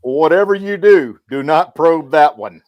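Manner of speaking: loud, slow, urgent warning; hammed-up alarmed alien warning; mock warning. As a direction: plead with them to stay away from it. Warning aliens about grizzly.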